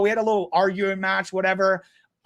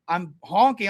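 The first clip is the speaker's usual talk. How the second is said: ordinary talk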